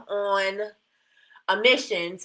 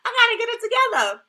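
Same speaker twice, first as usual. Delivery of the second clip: high-pitched; 13 semitones higher, 4 dB louder than usual. Real, not acted. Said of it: "do I sound like I have a lisp".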